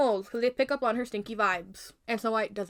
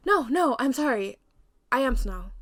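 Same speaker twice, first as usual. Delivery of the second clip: higher, louder interruption; raised, hurried apology; urgent protest; 3 semitones higher, 6 dB louder than usual. Acted. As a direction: cut in anxiously to stop her.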